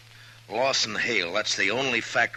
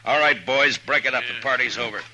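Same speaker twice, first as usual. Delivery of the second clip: loud, higher call across the room; 5 semitones higher, 7 dB louder than usual. Acted. Marshal calls out to the hangers-on in the saloon, loud and commanding. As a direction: order the crowd to clear off.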